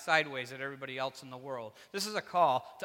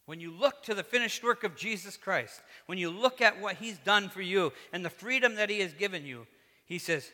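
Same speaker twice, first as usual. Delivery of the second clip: ordinary delivery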